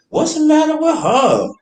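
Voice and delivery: high-pitched baffled question; retold puzzlement. People wondering about his ex's attitude, baffled. Retelling others' remark on ex.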